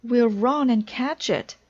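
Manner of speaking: rally your friend to give chase; excited high call to action; urgent. A narrator's reading. Frog urges chase after list.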